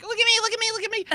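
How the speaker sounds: high, mocking narcissist voice; mocking girls. Girls preening for the camera, high mock voice. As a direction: squeal it like a vain selfie-taker. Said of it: "so they're all like".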